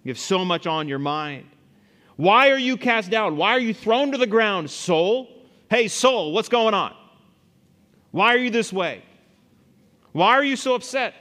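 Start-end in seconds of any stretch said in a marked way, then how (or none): 2.2-11.1 raised, insistent self-questioning; psalmist challenging himself. Acted. Man calls his own soul to account, loud challenge. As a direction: confront your soul, high and loud. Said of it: "He talks to himself".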